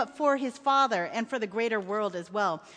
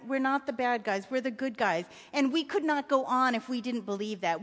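plain reading voice

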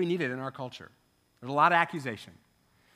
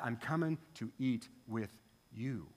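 softer and lower, tender; gentle invitation; 6 semitones lower, 8 dB quieter than usual. Retold or imitated. Jesus inviting himself to Zacchaeus's home, soft and tender. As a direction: offer it quietly, like an open door.

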